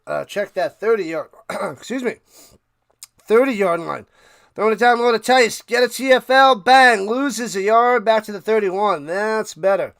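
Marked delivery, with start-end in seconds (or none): 4.6-9.1 louder, quick broadcaster call; play-by-play call. Acted. Streamer calling the play for his viewers, punchy play-by-play. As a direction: call the tackle for loss like a commentator.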